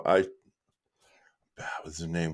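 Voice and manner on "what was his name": whispered, trying to recall a name; soft unvoiced self-question; hushed aside while thinking; whispered to himself. Real, not acted. Muttering to himself.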